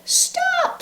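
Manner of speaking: very high sharp squeak. Acted. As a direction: squeak out to stop her. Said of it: "squeaked Mouse".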